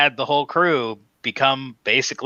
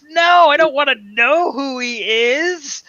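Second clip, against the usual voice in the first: much higher, loud, slow whine; mock Joker denial; 13 semitones higher, 5 dB louder than usual. Fan voicing Joker refusing Batman's identity, high slow whine. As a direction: plead it like a cartoon villain. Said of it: "He's like".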